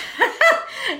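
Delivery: real laugh, high and bright; laughing at her own cheeky example. Real, not acted. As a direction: break into a laugh.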